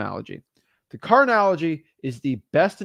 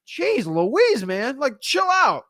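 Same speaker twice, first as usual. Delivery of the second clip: exasperated; 9 semitones higher, 3 dB louder, much slower than usual. Retold or imitated. Caller reacting to pushy seller.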